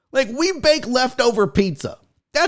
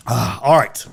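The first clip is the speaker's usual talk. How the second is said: normal talking voice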